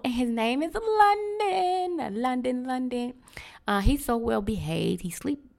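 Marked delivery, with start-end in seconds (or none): 0.0-3.1 high, slow, doting puppy voice; excited. Real, not acted. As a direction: sing out the dog's name. Names her new puppy.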